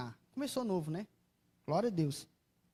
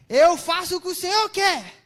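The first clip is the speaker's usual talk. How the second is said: high, loud, resentful vow; obey through clenched resentment; preacher reenacting grudging obedience to God, loud and resentful; reluctant obedience; 9 semitones higher, 11 dB louder than usual. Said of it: "minha revolta".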